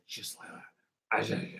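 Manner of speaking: hushed, husky comedian catchphrase, drawn out; low, slow comic impression, nearly all breath; Tommy Cooper. Artist quoting a comedian after saying it himself, hushed comic impression. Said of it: "That's my Tommy Cooper impression".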